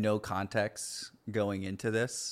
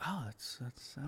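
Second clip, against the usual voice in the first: very soft, higher aside; hushed recognition; 4 semitones higher, 15 dB quieter than usual. Retold or imitated. Spotting Elliot walking around the exhibit, hushed recognition. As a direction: murmur it as if he might overhear. Spotting a local celebrity nearby.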